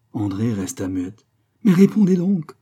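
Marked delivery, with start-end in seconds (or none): 1.6-2.6 loud, high outburst; impatient demand. A narrator's reading. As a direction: demand an answer, voice leaping up. Queen demands an answer from silent rival.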